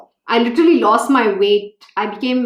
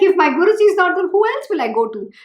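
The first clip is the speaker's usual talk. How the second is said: put-on higher, fast replay of a lost child's plea; pitched-up mock despair, rushed; retold helpless plea; 8 semitones higher, faster than usual. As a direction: relive the helplessness, lightly acted. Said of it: "I'm like".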